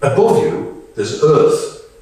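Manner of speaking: ordinary talk, nothing marked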